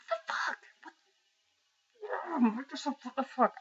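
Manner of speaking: high, loud outraged ranting; real angry rant. Real, not acted. Gamer raging at Microsoft over fantasy football at an Xbox launch, angry. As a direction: blow up in exasperated fury. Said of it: "I'm really fucking angry about this shit".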